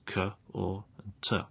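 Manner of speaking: k, or, t as three isolated phonemes; separate sounds. Shown as a demonstration. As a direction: softly sound out k, or, t one at a time. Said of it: "but only three sounds".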